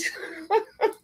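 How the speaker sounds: high laugh that cracks toward crying; a real laugh close to tears; laughing sob. Real, not acted. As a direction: laugh breaking high and strained. Laugh breaks into the talk.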